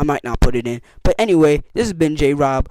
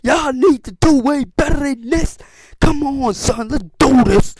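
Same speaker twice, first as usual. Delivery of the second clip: rallying shout; 8 semitones higher, slower than usual. Retold or imitated. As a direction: fire up the team. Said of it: "So the next week I said".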